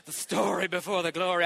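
quick burst of preachery oratory; preacher voice. Shown as a demonstration. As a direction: proclaim it like a preacher. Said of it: "jumped into my preacher voice".

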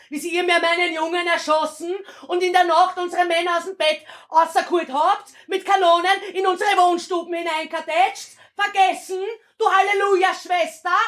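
building from bitter to screaming fury; impassioned stage tirade. Acted. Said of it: "Du hast da voll alles gegeben".

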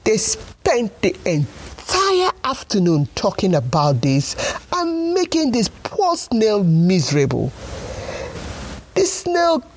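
put-on lively storybook narration for kids; story narration. A narrator's reading. Storyteller reading to children, bright and lively. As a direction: tell it like a bedtime tale.

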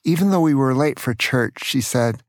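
plain reading voice

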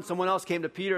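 ordinary talk, nothing marked

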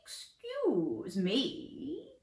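slow, drawn-out stranger's voice. Acted. Chameleon coach interrupting Dan with a slow voice. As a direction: stretch it out like a curious stranger. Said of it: "a strange voice".

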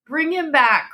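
high desperate demand; cry. A narrator's reading. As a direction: plead it as a command. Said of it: "cried the old woman".

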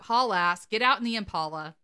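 ordinary talk

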